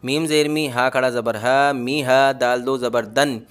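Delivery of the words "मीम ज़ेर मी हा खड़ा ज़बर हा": ordinary talk, nothing marked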